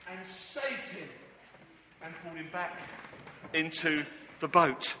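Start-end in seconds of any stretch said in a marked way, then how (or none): none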